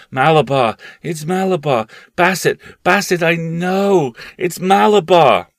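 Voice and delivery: feverish cries. Acted. Sick boy raving in fever to his family, loud and high. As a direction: rave it as a fevered child. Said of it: "So the child cried".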